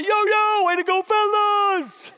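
high, loud crowd hype; hammed-up cheering. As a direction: cheer the boys like fans. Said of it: "freaking out like".

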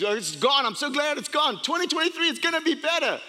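high-pitched, hammed-up glee; mock elation. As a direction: gush with relief, pitch climbing. Imitating gleeful new-year people.

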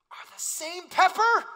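high, loud, slow astonishment; amazed reveal. Retold or imitated. Wife revealing her pepper discovery, high and loud with amazement. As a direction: land the punchline with wide-eyed wonder. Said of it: "She goes".

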